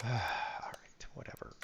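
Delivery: sighing whisper, giving up; resigned whisper. Real, not acted. Streamer muttering after a mistake, whispered. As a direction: breathe it out under the breath.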